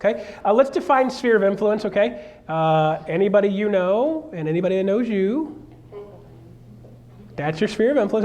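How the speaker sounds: usual speaking voice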